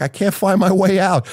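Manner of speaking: high imitated panicked cry; retold panic. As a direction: pitch it up in helpless alarm. Trapped in closet, panicking.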